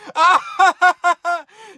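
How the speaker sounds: high, loud, rising cackle of delight; gleeful laugh. Real, not acted. Fan celebrating the rival's loss, high gleeful laugh. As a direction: laugh out the pure joy of the win.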